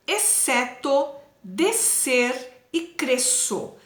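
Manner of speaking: each word read very slowly; slow reading aloud. Shown as a demonstration. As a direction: spell the words out slowly for the class. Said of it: "Leia em voz alta". (leitura em voz alta bem devagar)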